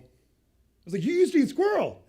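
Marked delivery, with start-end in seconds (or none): 1.0-2.0 high, loud disbelief on "used"; you can't be serious; shocked question. Retold or imitated. Reacting to the coworker's lunch story, high loud shocked question. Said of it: "I'm asking it as a question".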